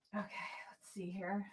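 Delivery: whispered to herself. Real, not acted. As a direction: breathe it out unvoiced. Muttering to self while shuffling.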